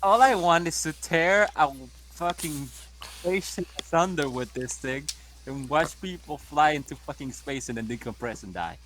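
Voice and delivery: ordinary delivery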